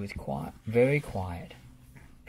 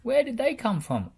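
higher, louder amazed question; 10 semitones higher, 5 dB louder than usual. A narrator's reading. Rolly asks about the hedgehogs, amazed. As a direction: wonder aloud. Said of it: "asked Rolly".

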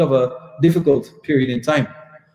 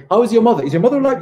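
higher, louder mock greeter; imitated eager questioning; 6 semitones higher, 4 dB louder, much faster than usual. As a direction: pepper them with eager family questions. Acting out Sudanese greeting questions.

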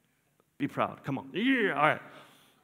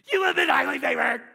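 high, loud, excited proclamation; bursting yell; 6 semitones higher, 5 dB louder than usual. Acted. Angel greeting Mary, bursting with an excited yell. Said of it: "busting at the seams".

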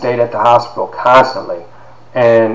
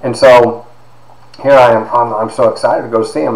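ordinary delivery

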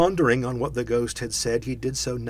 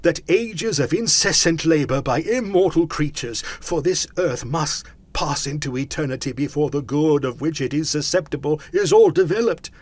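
loud, raised ghostly lament; anguished cry; 6 semitones higher, 7 dB louder than usual. A narrator's reading. Phantom regretting its misused life, crying out in anguish. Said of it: "cried the phantom".

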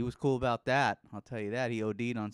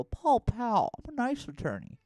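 much higher, sweet mock nice-guy voice; nice little attorney; 10 semitones higher than usual. Mocking a nice attorney ad, high and sweet. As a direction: play it small and sugary. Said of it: "like, the nice little".

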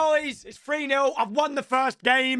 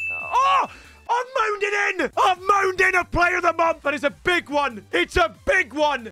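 excited shouting; 8 semitones higher than usual. Real, not acted. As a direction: shout it out like you just hit the jackpot. Player of month revealed.

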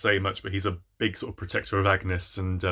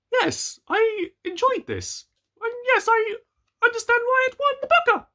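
gushing inner voice; 4 dB louder than usual. Retold or imitated. Booktuber telling viewers her verdict, high gushing mock voice. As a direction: squeal it like a delighted fan. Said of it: "overall I was like".

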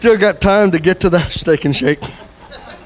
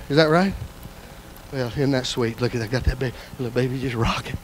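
tender aside; 8 semitones lower, 11 dB quieter than usual. Real, not acted. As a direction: drop to a hushed, fond aside. Cooing over baby in congregation.

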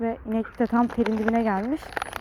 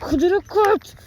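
playful teasing; 7 semitones higher, 7 dB louder, slower than usual. Real, not acted. Teasing the excited dog.

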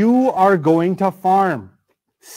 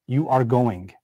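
low, settled certainty; matter-of-fact command, falling at the end; statement of fact; 7 semitones lower, 4 dB quieter, slower than usual. Shown as a demonstration. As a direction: state it as already decided. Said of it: "that's actually a statement of fact".

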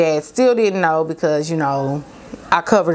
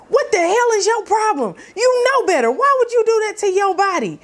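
angry dad yelling; 13 semitones higher than usual. Retold or imitated. Father discovering daughter's tattoo, yelling in anger. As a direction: explode at her like a livid father.

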